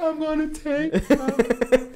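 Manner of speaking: put-on very high, slow girly squeal; mock girl voice. Girl's line in loud club.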